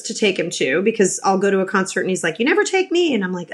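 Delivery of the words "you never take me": higher-pitched mock kid protest; imitated child's complaint. Son complaining to mom about concerts, imitated protest.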